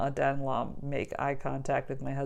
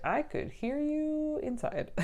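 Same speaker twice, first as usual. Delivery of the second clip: mock nurse voice; 11 semitones higher, 5 dB quieter, slower than usual. Nurse quoted in retelling.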